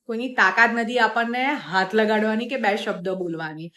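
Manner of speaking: normal talking voice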